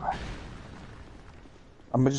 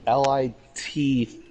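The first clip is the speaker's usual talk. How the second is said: spelled out slowly; slower than usual. Real, not acted. Spelling out the word lit.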